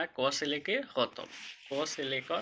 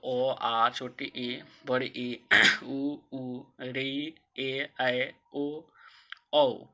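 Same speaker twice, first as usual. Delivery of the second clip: reciting the vowels slowly; 2 semitones lower, much slower than usual. Shown as a demonstration. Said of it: "ये ग्यारह कौन कौन होता है?".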